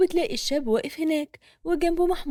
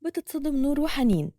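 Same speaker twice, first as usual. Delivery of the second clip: ordinary delivery, nothing marked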